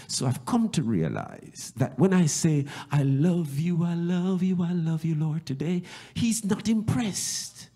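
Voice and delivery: high, loud, rising preacher's cry; preaching at full force. Acted. Pastor preaching to the church, climbing to a loud cry.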